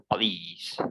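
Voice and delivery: puh-leez, quietly; police repeated softly; British police. Shown as a demonstration. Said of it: "we pronounce it as police".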